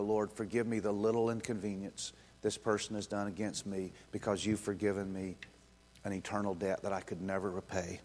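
soft, low, earnest petition; humble prayer. Acted. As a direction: pray quietly, humbled by mercy. Acting a humble prayer of forgiveness.

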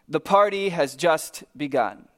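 normal talking voice

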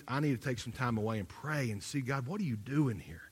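a soft, inward plea to God; quiet prayer. Retold or imitated. Pastor retelling his prayer on vacation, quiet. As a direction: ask God under your breath.

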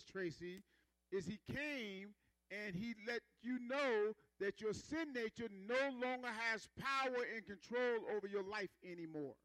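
normal talking voice

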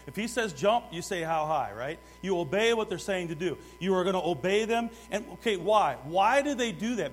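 loud, driving sermon voice; full-force preaching. Acted. Preacher rousing the church to obey, full force. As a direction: preach it hard to the congregation.

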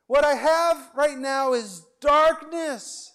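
high, strained voice of a grieving believer; despairing believer. Retold or imitated. Preacher voicing a grieving listener, high and anguished. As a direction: cry out that you can't give thanks. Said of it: "You might say".

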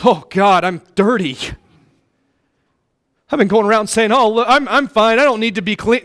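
self-satisfied sinner. Retold or imitated. Preacher voicing a careless believer, a hint of smugness. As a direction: play the smug one who thinks he's fine. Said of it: "You need to recognize".

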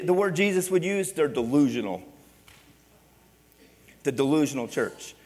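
usual speaking voice

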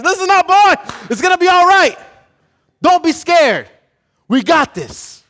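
loud, high drill-sergeant bark; rally the scared private; big commanding sergeant yell; shouting sergeant. Retold or imitated.

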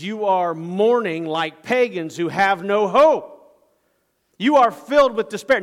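preacher's reproach, climbing in pitch; frustrated rebuke. Acted. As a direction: rebuke the mourners with rising force. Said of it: "It's frustration with the group".

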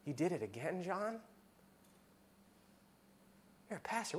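hushed voice of inner shame; inner self-accusation. Retold or imitated. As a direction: accuse yourself quietly in the mirror. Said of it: "I know that's how I think".

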